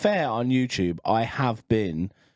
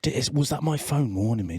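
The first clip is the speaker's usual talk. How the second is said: ordinary talk, nothing marked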